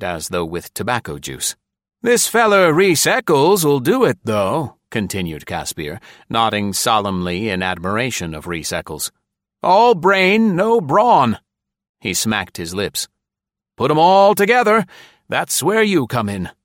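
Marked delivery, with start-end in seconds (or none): 2.0-4.8 much higher, loud neighing admiration; admiring colonel. A narrator's reading. Colonel praises Reese Eccles, admiring and high. 9.6-16.5 much higher, loud neighing voice; neighing colonel. A narrator's reading. Colonel tells the lieutenant his job, high and loud.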